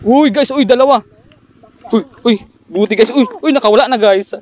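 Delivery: high, loud excited cries of uy; thrilled by a double catch, then losing it; excited yelps. Real, not acted. Fish caught then escaping.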